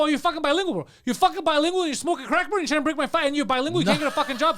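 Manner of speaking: high-pitched angry rant; acting out a furious tirade; retold going crazy. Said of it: "So I start going crazy".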